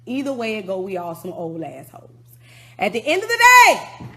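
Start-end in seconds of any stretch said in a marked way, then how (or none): 2.8-4.1 shouted high and loud; a ranting shout; angry outburst. Real, not acted. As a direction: blast it out in frustration. Ranting at rappers, sudden outburst.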